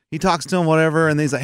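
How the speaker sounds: ordinary delivery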